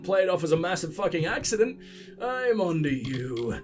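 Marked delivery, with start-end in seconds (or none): 2.2-3.6 slow, low mock-menacing warning; mock suspicious. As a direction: narrow your eyes and drag it out. Suspects the guard's threat.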